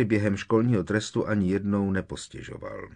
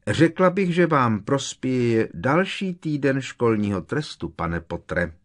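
high, oily teacher voice; slimy sweetness; 6 semitones higher, 5 dB louder than usual. A narrator's reading. Umbridge gives Harry another week of detention, oily-voiced. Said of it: "poznamenala profesorka Umbridgeová slizkým hlasem".

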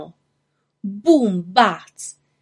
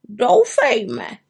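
raised raven character voice; lightly voiced croaky bird; croaking raven; 4 semitones higher than usual. A narrator's reading. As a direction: croak it doubtfully, a bit higher. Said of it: "zakrákal Havran".

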